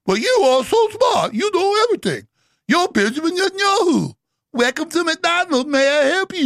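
butter him up with fake sweetness; sarcastic mock praise. Real, not acted. Host sneers at Netanyahu, sweet and sarcastic.

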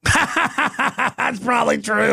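real laugh spilling into slow words. Real, not acted. Laughing at his own riff.

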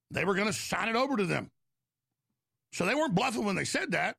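normal talking voice

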